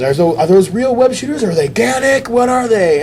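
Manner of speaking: high, eager rapid questions; high-pitched curious kid. Retold or imitated. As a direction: pepper him like an excited kid. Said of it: "the kid is asking him questions".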